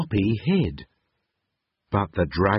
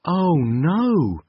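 alarmed; 8 semitones higher than usual. A narrator's reading. Rock starts to fall.

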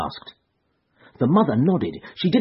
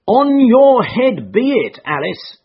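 much higher, louder and slow, a curse; bitter cry; 11 semitones higher, 7 dB louder, slower than usual. A narrator's reading. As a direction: hurl it at Alice in anguish. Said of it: "cried her mother".

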